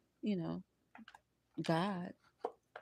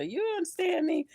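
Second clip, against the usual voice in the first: high rhetorical question; 10 semitones higher than usual. Real, not acted. Rhetorical check to audience.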